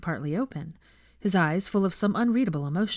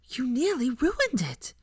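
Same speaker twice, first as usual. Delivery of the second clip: fierce whisper; 7 semitones higher, slower than usual. A narrator's reading. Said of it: "she whispered fiercely".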